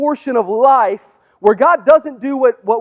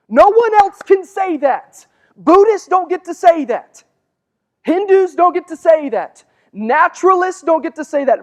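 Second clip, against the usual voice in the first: high, loud, building preacher's proclamation; preaching at full force; 8 semitones higher, 5 dB louder than usual. Real, not acted. Pastor proclaiming to the church, high and loud. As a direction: hammer each line home with rising fervor.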